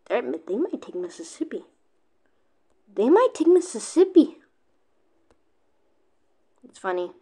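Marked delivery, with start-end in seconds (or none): 2.9-4.4 higher, louder excited prediction. Real, not acted. Gamer hyping viewers on the Mississippi takeover, excited. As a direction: hype it up on the repeat.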